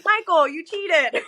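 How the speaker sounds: slow, high teasing accusation; mock accusation. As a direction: drag it out like a playful scolding. Friends teasing patient about cheating.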